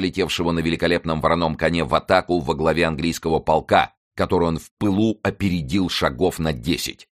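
plain reading voice

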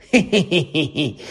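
вищий дрібний сміх пустуна; захихочи з жертви розіграшу; єхидне хихотіння. Acted. Півз регоче з Філча. (єхидно хихоче)

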